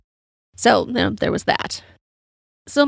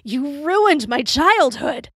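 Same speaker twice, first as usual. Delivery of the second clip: slow, higher-pitched mock outburst; retold yell; 5 semitones higher, much slower than usual. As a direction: stretch it out as a drunken accusation, without full volume. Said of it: "I yell out".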